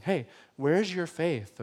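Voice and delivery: soft, slow, kindly asked; gentle question. Pretend. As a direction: ask it tenderly, no rebuke. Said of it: "just kinda telling them".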